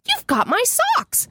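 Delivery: high mock accusing voice; husband accusing. Imitating husband telling son he has his socks. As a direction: play him pointing out the socks. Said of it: "he goes".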